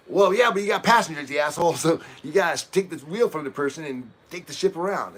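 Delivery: heated retort. Retold or imitated. Arguing with stubborn ship captain.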